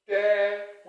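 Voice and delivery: TEM- held long and loud; stretched, loud "tem-" on its own; first syllable of tempest. Shown as a demonstration. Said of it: "we normally pronounce it as what".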